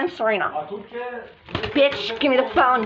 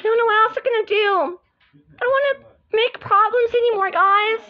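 higher doll voice; high girl voice; 9 semitones higher than usual. Pretend. Different doll apologises.

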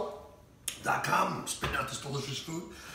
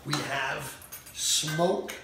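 slow dramatic reveal; much slower than usual. Acted.

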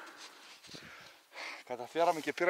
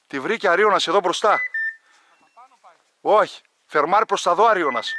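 loud, higher calls across the brush; calling out; 5 semitones higher, 9 dB louder than usual. Real, not acted. Hunter shouting directions while his dog points a bird. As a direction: shout directions to the dogs and hunting partner. Directing dogs and partner loudly.

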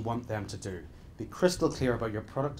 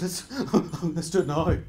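mocking eager pushiness; 5 semitones higher than usual. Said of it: "Everybody goes".

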